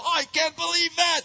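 high, loud exasperated cry; acted furious disbelief; angry outburst. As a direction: erupt as the temper rears up. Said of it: "ugly little sinful nature rears up".

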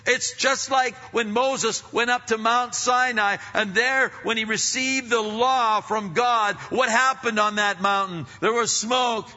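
high, loud, driving preacher's cry; full-force preaching. Acted. Preacher thundering to the congregation about creation shaking.

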